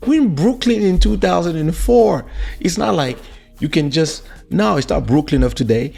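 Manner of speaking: laughing high-pitched Brooklyn rant; emphatic comic rant. Real, not acted. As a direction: hammer home the danger.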